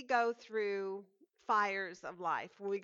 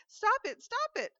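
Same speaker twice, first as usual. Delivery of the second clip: high, loud pleading cry; 13 semitones higher, 6 dB louder than usual. Acted. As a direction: beg him to stop, voice up high. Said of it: "I cried".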